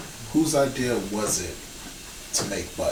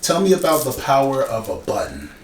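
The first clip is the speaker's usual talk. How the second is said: loud, higher, falling at the end; emphatic prompt; 5 semitones higher, 7 dB louder than usual. Real, not acted. Host cueing guest on the marketing campaign, loud and emphatic. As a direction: pitch the topic with big host energy.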